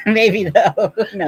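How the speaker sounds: laughing. Real, not acted. Giggling at own joke.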